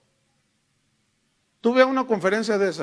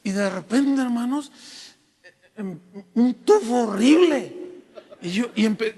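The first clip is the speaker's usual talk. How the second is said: normal talking voice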